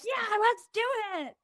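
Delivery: excited yes. Retold or imitated. Emotional person on a high agreeing to the event, excited. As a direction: jump at the invite on a high. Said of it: "when they're in a high, they're gonna be like".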